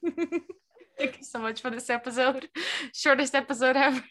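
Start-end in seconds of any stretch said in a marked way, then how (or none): 0.0-2.5 laughing, slowed words; laughing sign-off. Pretend. Host jokingly ending the episode early, laughing. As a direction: laugh through a pretend goodbye.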